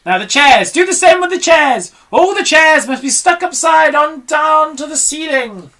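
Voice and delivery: high loud eager rush; excited. Acted. Mugglewump, thrilled, urges the crew on. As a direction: bubble over with excitement. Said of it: "I'm so excited".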